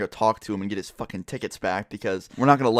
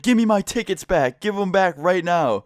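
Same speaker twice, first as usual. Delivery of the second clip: mock tantrum, much higher, demanding; lightly hammed-up kid tantrum, higher and slowing at the end; bratty kid freaking out; 7 semitones higher, 3 dB louder than usual. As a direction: play the robbed bully throwing a hissy fit. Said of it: "this kid freaks out".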